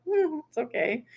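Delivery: high-pitched, forgiving reply; retold reassurance. Vlogger excuses neighbor's opening mail, high reassuring voice.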